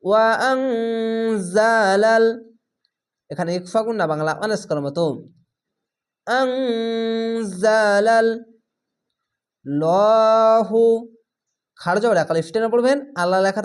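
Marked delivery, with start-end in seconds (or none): 0.0-2.2 slow drawn-out tajweed chanting; Quran recitation. Shown as a demonstration. Recited with ikhfa ghunna. 6.3-10.8 slow drawn-out tajweed chanting; Quran recitation. Shown as a demonstration. Recited, heavy Allah lam.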